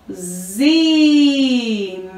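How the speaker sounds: ZI with a long, held I; teacher sounding out a long "zi"; syllable sound demo. Shown as a demonstration. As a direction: buzz the Z, then stretch the I in "zi". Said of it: "a junção do Z com o I".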